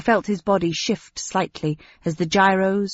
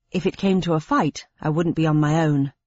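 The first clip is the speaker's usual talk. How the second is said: ordinary delivery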